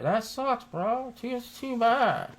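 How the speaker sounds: high, slow, fake-consoling tone; mock sympathy. To the crash driver, high mock-sympathetic voice. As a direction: pretend to care, a little too sweetly.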